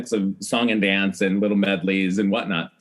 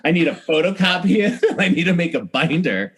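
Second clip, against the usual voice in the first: eager and laughing, a bit higher; excited, laughing; 6 semitones higher, 3 dB louder than usual. Retold or imitated. Answering the wardrobe guy, excited and laughing. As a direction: gush it out through laughter.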